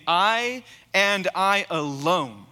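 I and I alone, each word slow and weighty; emphatic declaration. Acted. Pastor voicing Jesus's claim to the church, slow and emphatic. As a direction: land every word like a verdict. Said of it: "saying emphatically with strong language".

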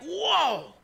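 high, stunned whoa at the thunder; amazed whoa. Retold or imitated. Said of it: "it's just like".

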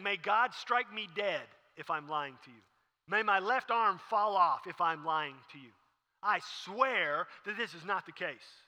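loud, slow, emphatic vow; swearing an oath. Acted. Peter swears to his accusers, slow loud oath. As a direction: call a curse down on yourself, forcefully. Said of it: "invoking a curse and to swear".

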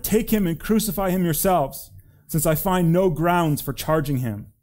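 forceful narrated protest; exasperated retort. A narrator's reading. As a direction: throw it back at the shouting mob. Pilate retorts to the shouting crowd.

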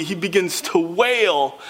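usual speaking voice

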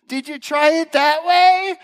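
very high, slow sing-song question; imitated helpful wife. Wife interrupting frustrated husband, mock sing-song helpfulness. Imitating his wife's advice.

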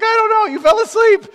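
high, loud helpless excuse; defensive protest. Retold or imitated. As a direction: wail it loudly in self-defence. Defending himself to brother.

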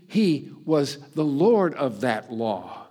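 normal talking voice

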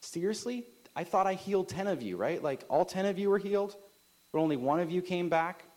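high-pitched, incredulous questioning; indignant. Acted. Jesus questions the one healed leper who returned, indignant. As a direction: act indignant, voice lifting in disbelief. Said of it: "like, just indignant".

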